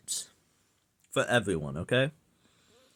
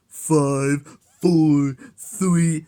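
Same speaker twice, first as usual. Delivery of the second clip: shouted countdown, louder and higher; loud mock countdown; 5 semitones higher, 7 dB louder, much slower than usual. Taunting viewers who count seconds, with a loud mock countdown. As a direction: count down at them, mocking and loud.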